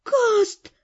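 put-on high, plaintive girl's appeal; desperately unhappy. A narrator's reading. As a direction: call his name miserably, high. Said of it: "бесконечно несчастной". (несчастно, жалобно, высоким голосом)

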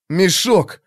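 sudden exclamation. A narrator's reading. Will slaps his forehead remembering, excited exclamation. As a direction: blurt out what you just remembered.

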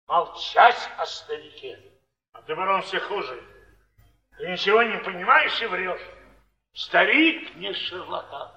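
ordinary delivery

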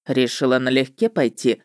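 low-pitched male witcher voice; ведьмак. A narrator's reading. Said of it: "голос ведьмака".

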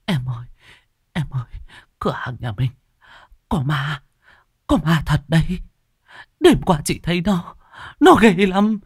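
high, halting, frightened confession; trembling with fear. Acted. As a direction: build from a weak plea to a terrified cry. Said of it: "Bà nhìn Hằng mà run rẩy".

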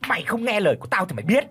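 high, fast roaring threat; mother-in-law threatening the wife, roaring; roared threat. A narrator's reading. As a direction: snarl it at her on the floor. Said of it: "gầm lên".

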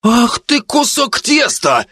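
very high, slow furious insult; angry yell. A narrator's reading. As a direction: start yelling, drawing out the words. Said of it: "начал орать Мичи". (злобный высокий крик)

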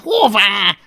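loud, high, drawn-out dragon whine; whining. A narrator's reading. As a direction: whine back in a put-on creature voice. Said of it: "nyafogott Fogatlan".

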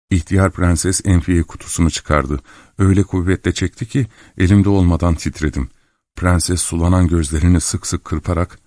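usual speaking voice

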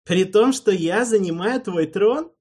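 a jeering, loud high taunt; mocking sneer. A narrator's reading. Alvin taunts Erald about the throne, jeering. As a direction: needle him with a sneer. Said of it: "с глумливым смешком".

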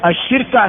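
الشركة as ash-shirka; shifted vowel. Shown as a demonstration. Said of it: "بنقل حركة الوسط إلى الفاء". (الشركة بكسر الشين وتسكين الراء)

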